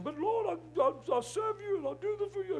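high-pitched whining moan to God; mock moaning. Broke pastor complaining to God, moaning. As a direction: moan it out like a hard-done-by complainer. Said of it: "he was moaning".

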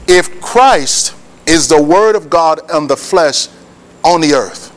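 measured teaching pace, pauses between phrases; slow, low, weighty explanation; slow and deliberate. Shown as a demonstration. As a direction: spell it out slowly so they get it. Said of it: "I'm gonna say it slow".

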